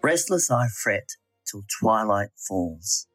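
ordinary talk

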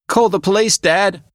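high, loud shout for help; yelled. Acted. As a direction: yell for dad in alarm. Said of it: "I yelled".

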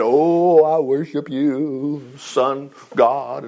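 slow, lightly put-on reverent voice; mock idol worship. Israelites praying to the sun god, mock reverent. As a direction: parody a drawn-out pagan prayer. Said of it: "they went away and they said".

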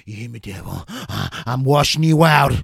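loud, ecstatic revival preacher; fervent exorcist preacher. Acted. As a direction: roar at the devil, full fervour. Exorcist ritual continues.

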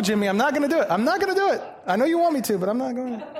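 teasing refusal. Real, not acted.

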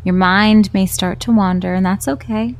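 calm, unhurried meditation voice with long pauses; guided meditation. Acted. Host guiding stressed marketers through mindfulness, calm and unhurried. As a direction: guide gently, leaving space after each instruction.

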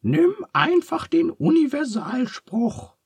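very high squeaky shout; Charles calling out. A narrator's reading. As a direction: call it in a tiny falsetto. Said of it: "ruft Charles".

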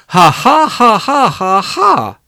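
loud, high, acted "ha ha" laugh; hammed-up baby tyrant cackle; villainous laugh. Baby Henry laughs at his brother, mock-villainous cackle. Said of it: "he laughed".

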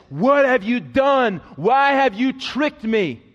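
loud, slow, accusing demand; outraged confrontation. Acted. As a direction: hurl the betrayal at him. Jacob confronting Laban.

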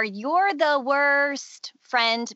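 inner critic's voice. Acted. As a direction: accuse slowly, stretching "worst". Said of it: "types of phrases that our inner critic can use".

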